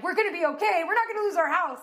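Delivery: high, eager promise; reliving the relief with a lift; excited reassurance. Retold or imitated. Assuring her husband they will keep the house, high and eager.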